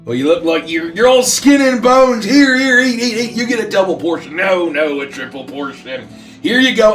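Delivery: high, loud fussing granny pushing food; guilt-trip grandma. Acted. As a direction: smother her with food, grandma style.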